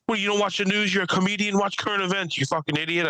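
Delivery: high, fast imitation of an irate guy; mock indignant outburst. Ranting comic telling off the next comedian, mock indignant.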